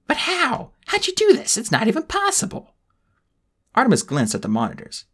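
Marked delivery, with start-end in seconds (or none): 0.1-2.7 high disbelief. Acted. Spiro disbelieving.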